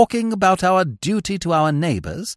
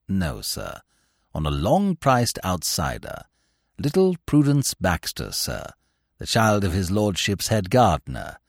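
lower, softer, measured valet; unflappable valet; 10 semitones lower, 4 dB quieter than usual. Acted. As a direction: keep it calm and deferential. Jeeves answers Bertie.

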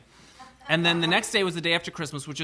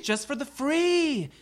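high; 10 semitones higher, much slower than usual. Pretend. Repeating the phrase in a put-on voice.

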